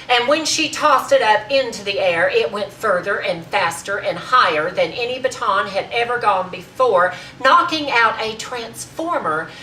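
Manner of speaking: loud, emphatic speech building to full force; proud, forceful oratory with punched words; indignant tirade. Acted. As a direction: defend your sister in a grand, ringing tirade. Acted tirade defending her sister to Marjorie.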